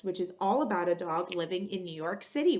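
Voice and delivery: usual speaking voice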